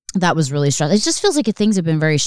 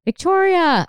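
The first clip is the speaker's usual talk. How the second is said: sing-song name; slower than usual. Acted.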